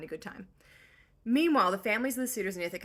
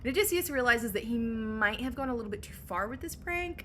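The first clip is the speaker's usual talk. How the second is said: ordinary talk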